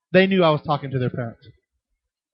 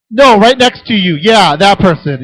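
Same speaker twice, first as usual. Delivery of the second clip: teasing call-out; 4 semitones higher, 13 dB louder, slower than usual. Retold or imitated. Teasing the eager boy.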